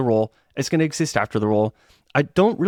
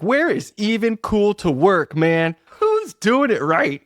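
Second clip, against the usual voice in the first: higher, slow, drawn-out exasperation; exasperated question; 8 semitones higher, slower than usual. Retold or imitated. While scrolling job listings, asking in slow mock exasperation. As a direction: stretch it out, pleading to nobody.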